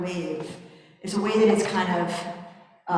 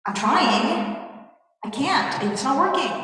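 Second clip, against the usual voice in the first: higher and louder, at the end of his rope; frustrated outburst; 7 semitones higher, 4 dB louder, slower than usual. Acted. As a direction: burst out in desperate frustration. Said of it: "He's frustrated".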